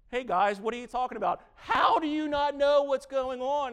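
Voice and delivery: fast, high, loud breezy voice; hammed-up, high and loud innocent question; mock Jesus. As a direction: play innocent, brightly asking. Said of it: "He's like".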